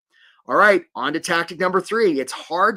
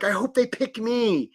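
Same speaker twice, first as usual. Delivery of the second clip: higher, slower, a touch pleading; mock needy hope; 8 semitones higher, slower than usual. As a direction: act out the anxious job seeker hoping to get picked. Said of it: "you don't wanna be in the business of scarcity and being like".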